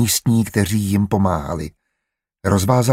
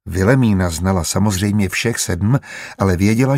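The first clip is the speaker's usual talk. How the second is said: plain reading voice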